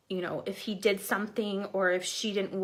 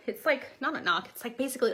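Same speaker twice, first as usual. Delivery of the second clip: normal talking voice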